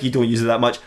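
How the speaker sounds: low, unimpressed repetition; deadpan disbelief, pitch dropped; sceptical echo. Acted. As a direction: throw the excuse back drily. Echoes the excuse back, unconvinced.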